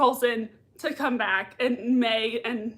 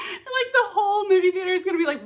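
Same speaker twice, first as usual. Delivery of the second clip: laughing; 9 semitones higher, 4 dB louder, faster than usual. Real, not acted. Laughing while telling.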